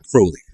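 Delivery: "Crowley" said with "Crow" like "crow" in "holy": CROH-lee; correct pronunciation. Shown as a demonstration. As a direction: stress the first syllable "Crowley", rhyming with holy. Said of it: "Rhymes with holy".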